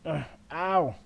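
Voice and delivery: sharp, loud ow of sudden pain; pained cry. Acted. Putting weight on her sprained ankle, a sharp pained cry. Trying to walk on sprained leg.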